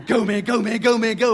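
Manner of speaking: imitating a rowdy cheer. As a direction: egg him on like a cheering crowd. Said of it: "everybody starts cheering".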